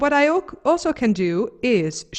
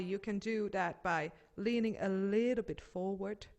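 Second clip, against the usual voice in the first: guided warm-up; 13 dB quieter than usual. Acted. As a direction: talk them gently through each movement. Teacher guides body warm-up.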